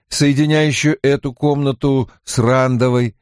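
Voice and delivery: plain reading voice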